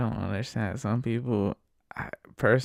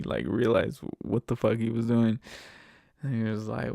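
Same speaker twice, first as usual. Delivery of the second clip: ordinary delivery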